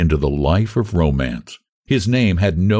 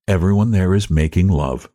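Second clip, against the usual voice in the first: ordinary delivery, nothing marked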